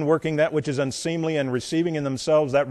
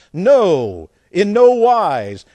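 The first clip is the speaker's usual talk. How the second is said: loud, drawn-out NO in "No, in no wise"; emphatic denial; 5 semitones higher, 8 dB louder, much slower than usual. Acted. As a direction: hit "No" hard and loud, stretch "no wise". Emphatic answer to scripture's question.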